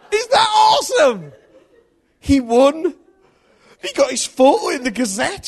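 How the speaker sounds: loud, high, driving sermon storytelling; preaching at full force. Acted. Preacher telling the church the race story, loud and high. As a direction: build the story up to a roar for the congregation.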